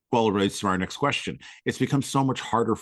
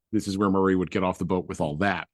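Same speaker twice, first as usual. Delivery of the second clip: usual speaking voice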